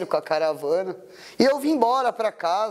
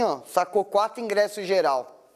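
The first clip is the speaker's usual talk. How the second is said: usual speaking voice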